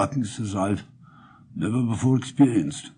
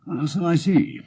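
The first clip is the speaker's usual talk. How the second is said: cool; 6 semitones higher than usual. A narrator's reading. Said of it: "with the utmost coolness".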